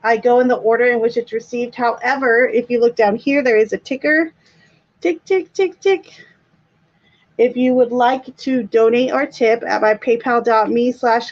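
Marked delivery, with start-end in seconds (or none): 5.0-6.1 ticking. Pretend. Imitates ticker sound.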